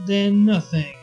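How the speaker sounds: ordinary delivery, nothing marked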